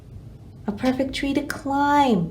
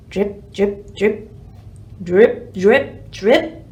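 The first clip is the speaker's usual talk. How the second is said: raindrops dripping; 3 semitones lower, 4 dB louder, much slower than usual. Acted. Rain dripping sound effect.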